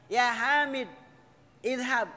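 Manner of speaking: "Hamid" stopped on d, a gap, then "izhab"; high, separated "Hamid... izhab"; pause between words. Shown as a demonstration. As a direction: break after "Hamid", then start "izhab" afresh, high and loud. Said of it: "Kalau mau dijeda".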